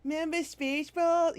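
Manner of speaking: high, sly tempting voice; mock nostalgia pitch. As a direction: dangle the memory like bait. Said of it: "it's, it's like".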